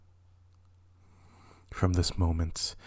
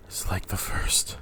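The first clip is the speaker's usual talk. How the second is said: hushed breathless words; dread-filled whisper; horrified whisper. Acted. Macbeth sees kings' line.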